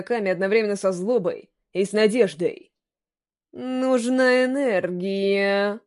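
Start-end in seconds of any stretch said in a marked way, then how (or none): 3.5-5.8 very slow, distorted eerie voice. Acted. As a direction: drag it out like an eldritch horror. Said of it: "искажённым голосом произнесла Ками".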